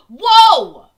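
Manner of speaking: loud, high astonished whoas; shocked shouting whoa. Real, not acted. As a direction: yell whoa in total surprise. Reacting to a big donation.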